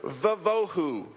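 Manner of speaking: "vavohu" said slowly as "va-VO-hu"; Hebrew word. Shown as a demonstration. Teacher having the class repeat "vavohu". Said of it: "Say, tohu".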